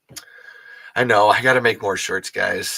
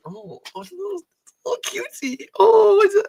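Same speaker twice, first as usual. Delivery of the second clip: squealing very high, half laughing; melting over something adorable; gushing over a cute toy. Real, not acted. Cooing over the plush again.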